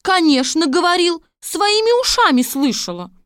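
high, loud confirmation; insistent servant woman. A narrator's reading. Servant vouching for the princess, high and insistent. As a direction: back her up at high pitch.

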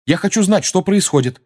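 blurted out. Acted. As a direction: blurt it straight out. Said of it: "мигом выпалил Гарри".

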